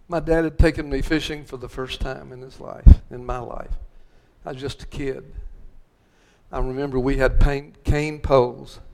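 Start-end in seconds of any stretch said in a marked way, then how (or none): none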